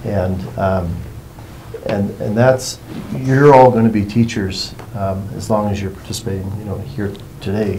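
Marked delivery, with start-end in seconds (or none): none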